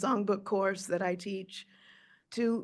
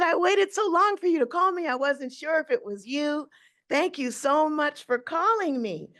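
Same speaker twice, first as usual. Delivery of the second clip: high, eager thanks; gushing young girl; 7 semitones higher, 3 dB louder than usual. Retold or imitated. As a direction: pour it out like a starstruck girl.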